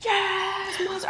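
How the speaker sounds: high-pitched gleeful yes; excited cheer. Retold or imitated. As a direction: cheer like a fan at the trucks. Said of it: "I'm like".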